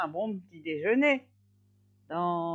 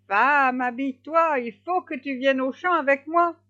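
loud, higher-pitched, urgent order; commanding husband; 7 semitones higher, 8 dB louder than usual. A narrator's reading. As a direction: hedgehog bossing his wife along. Husband orders his wife. (mari autoritaire, voix forte)

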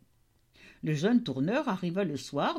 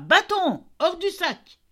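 high, loud storybook command; crying out; 11 semitones higher, 5 dB louder than usual. A narrator's reading. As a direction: call out the magic order sharply. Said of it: "il s'écria". (ordre crié d'une voix aiguë)

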